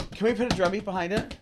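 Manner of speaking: winded after dancing; panting between words; out of breath. Real, not acted. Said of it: "I am winded".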